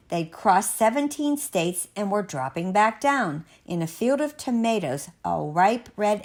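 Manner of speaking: usual speaking voice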